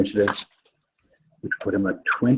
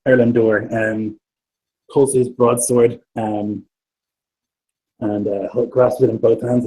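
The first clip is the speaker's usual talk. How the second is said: usual speaking voice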